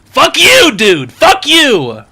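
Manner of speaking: loud, high furious shout; furious outburst. Acted. Comedian yells at the nosy cashier, furious shout. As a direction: yell it right back at him, outraged.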